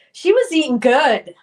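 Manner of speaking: big, loud, emphatic admiration; loud exclamation. Real, not acted. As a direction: belt it out, impressed. Loud admiring remark about barbecue.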